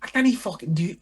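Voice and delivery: high-pitched bad Scottish; attempted Geordie veering into Scottish; accidental Scottish accent. Shown as a demonstration. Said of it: "Nah, Scottish, that is".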